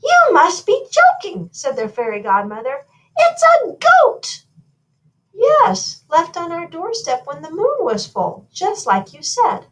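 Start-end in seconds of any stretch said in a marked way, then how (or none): 0.0-1.5 very high, loud godmother disbelief; incredulous. A narrator's reading. Fairy godmother is shown the goat, high incredulous outcry. 3.2-4.4 very high, loud, drawn-out disbelief; aghast. A narrator's reading. Fairy godmother sees the child is a goat, aghast high cry. 5.3-9.7 high-pitched royal explaining; insistent royal. A narrator's reading. Royals remind godmother of her promise, high insistent voice.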